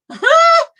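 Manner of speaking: high, loud burst of laughter; a real laugh of delight; squealing laugh at a cute find. Real, not acted. Delighted by a little kitchen.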